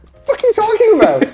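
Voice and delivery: high, loud incredulous outburst. Retold or imitated. Voicing other characters' disbelief.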